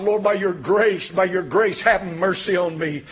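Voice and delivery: ordinary delivery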